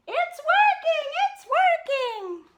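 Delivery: high, loud, slow cry of triumph; triumphant shout. Acted. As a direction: crow with delight. Papa Bear's machine runs.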